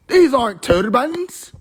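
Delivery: high, slow dawning alarm; alarmed realization. Acted. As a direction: let the penny drop, voice jumping up.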